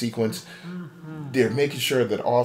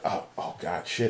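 dismayed under breath; 6 semitones lower, 7 dB quieter than usual. Acted. Guard sees old Bonnie standing.

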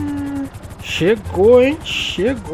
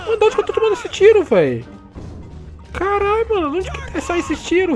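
shrill-free high fast yelps of alarm; high panicked disbelief; 12 semitones higher than usual. Real, not acted. Hit from an unseen shooter.

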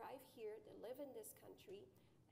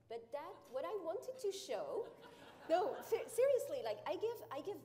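laughing, louder and higher as she protests; 7 semitones higher, 13 dB louder than usual. Real, not acted. To the panel about her English, laughing. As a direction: laugh it off, insisting through the giggle.

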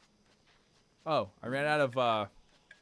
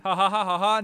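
1 mock gloating laugh; 3 semitones higher, 6 dB louder than usual. Triumphant mock laugh on mountain.

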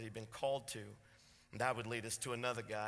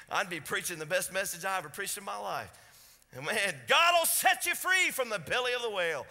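loud, high revival preaching; full-force preaching; 7 semitones higher, 7 dB louder than usual. Acted. Preacher tells the church how Jonah preached, loud and high. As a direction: lift the church with a rising shout.

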